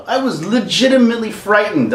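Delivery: usual speaking voice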